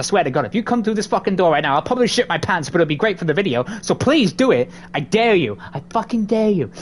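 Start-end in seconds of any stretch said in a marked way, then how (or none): none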